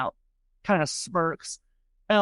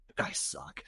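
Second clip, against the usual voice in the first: much softer sulky whisper; whispered gripe; 11 dB quieter than usual. Acted. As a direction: breathe it out under your breath like a sulking kid. [name] mutters a complaint.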